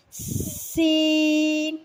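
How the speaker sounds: سین with a long sharp hiss; hissing seen. Shown as a demonstration. Teacher demonstrating the hissing سین. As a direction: stretch the سین with a whistle. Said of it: "سین میں کچھ اس طرح ادائیگی کرنی ہے".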